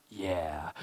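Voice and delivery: low, soft, drawn-out yeah; mock-awed low murmur; imitating cool admiration. Recalls boyish awe at pilot.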